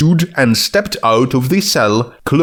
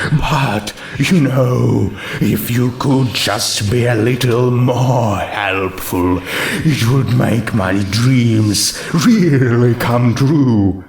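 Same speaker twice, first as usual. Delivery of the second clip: character voice; slower than usual. Acted. Autumn Sky character voice.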